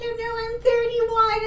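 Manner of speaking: high, slow, put-on coy voice; mocking, high-pitched giggly girl; mock giggly woman. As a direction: imitate her high and drawn out. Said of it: "She's giggling".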